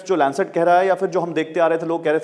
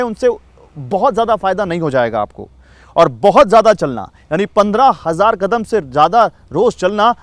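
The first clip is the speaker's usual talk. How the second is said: emphatic news voice-over, louder and livelier; news report read; 4 semitones higher, 5 dB louder than usual. Real, not acted. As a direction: deliver it as a punchy scripted TV news story. Reads a scripted news report.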